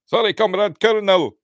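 high, apologetic Russian soldier; Russian accent. Acted. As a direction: squeak out a comic Russian underling's apology. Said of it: "putting Russian accents on, like me".

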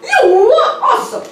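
high mock-Italian jeer; Mario accent. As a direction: taunt in a squeaky cartoon Mario accent. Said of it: "talking in your Mario accent".

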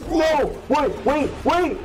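high, frantic cries to stop; panicked protest. Real, not acted. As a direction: yelp in sudden alarm. Caught by enemy in game.

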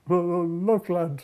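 Billy stammering as he spots the sleeping man; stuttering. Retold or imitated. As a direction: stumble over the first word, then blurt it out. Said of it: "he stuttered".

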